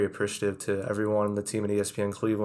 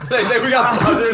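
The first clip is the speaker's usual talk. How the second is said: much higher, louder playful call; excited joke; 12 semitones higher, 9 dB louder than usual. Real, not acted. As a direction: hype it like a game show. Joking about game-show buzzers.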